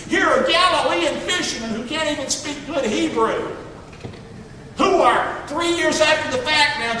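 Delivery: fervent pulpit shout; preaching shout. Real, not acted. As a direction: drive it home to the congregation. Preacher builds to emphatic shout.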